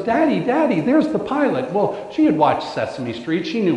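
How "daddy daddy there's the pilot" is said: high-pitched mock excitement of a small daughter; excited little girl. Small daughter spots the pilot and calls to her daddy, excited. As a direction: imitate an excited four-year-old, higher pitched. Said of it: "she gets all excited and goes".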